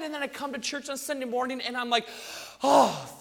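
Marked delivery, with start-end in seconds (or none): 2.6-3.2 big, loud exhaled oh; relieved sigh. Acted. Arriving at church after a week of self, relieved. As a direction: let it all out in acted relief.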